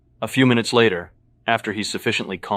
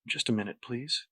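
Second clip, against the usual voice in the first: very soft, barely voiced request; mouthed request; 4 semitones higher, 10 dB quieter than usual. A narrator's reading. Patrick signals the guard to wait, mouthed softly. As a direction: mouth it softly, nearly soundless. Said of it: "look back up ... and mouth".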